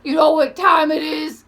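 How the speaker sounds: loud call from across the road; a stranger shouting over to ask the time; yelling across the street. Retold or imitated. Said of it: "yells from across the street".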